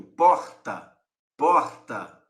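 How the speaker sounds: "Porta" with a throaty Rio R; "Porta" with the R rasped in the throat, carioca style. Shown as a demonstration. A teacher showing students the Rio R in "Porta". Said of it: "ouvir o R fluindo aqui da minha garganta".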